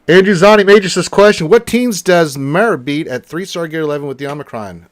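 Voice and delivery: big, booming question call; announcing a question. Real, not acted. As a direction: belt out the question to the room.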